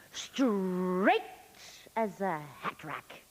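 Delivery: theatrical monologue with long, lingering pauses; sensual Molly Bloom. Acted. Molly Bloom recalling a lover to the audience, sensual dramatic monologue. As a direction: savour each phrase, swelling then dropping to hushed.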